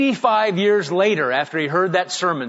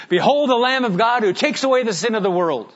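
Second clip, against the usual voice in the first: higher, fuller call across the village; the bolder second call, higher and more lifted; calling out again; 6 semitones higher, 3 dB louder than usual. Retold or imitated. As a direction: proclaim the verse, lifted and ringing. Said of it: "I'm just gonna do it again".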